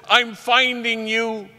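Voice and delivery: high, slow, drawn-out joy; overjoyed. Retold or imitated. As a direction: imitate an overjoyed kid at the door. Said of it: "but so overjoyed".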